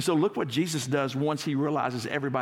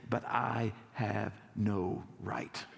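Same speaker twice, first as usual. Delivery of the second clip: slow and quiet, each word weighed; hushed, humble confession; hushed solemn vow; 4 semitones lower, 6 dB quieter, much slower than usual. Acted. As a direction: lay it down gently, no right at all. Jesus quoted, solemn slow line.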